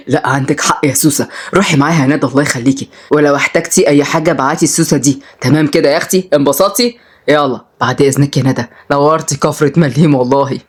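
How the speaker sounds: low male-character voice, amused; easygoing Oday. Acted. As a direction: humour the girl and say goodbye in a low voice. Voices Oday agreeing, taking leave. (بصوت واطي، هزار وود)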